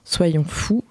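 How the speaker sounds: ordinary talk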